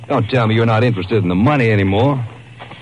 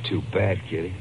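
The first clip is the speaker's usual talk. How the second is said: much softer, cold and low; quiet menace; 4 semitones lower, 10 dB quieter than usual. Acted. Gangster to the doomed girl, soft menacing murmur. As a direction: let the threat sit softly. Gangster about to have her killed.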